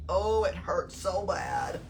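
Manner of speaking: ordinary delivery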